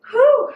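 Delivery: high puffing exhale; winded whew. Real, not acted. Trainer mid-squats, a breathless whew. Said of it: "I am definitely out of breath".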